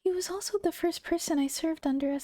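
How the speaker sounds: maid's story narration. A narrator's reading.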